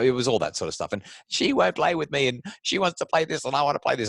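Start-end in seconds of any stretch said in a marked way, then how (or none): 1.3-4.1 put-on high whiny child, mid-fight; squabbling kid voice. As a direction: play the kids bickering over toys. Kids complaining during chaos.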